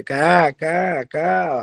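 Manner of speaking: high drawn caw; crow's caw. Acted. Crow calls out to the deer, cawing. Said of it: "કાગડા ભાઈ બૂમ પાડે".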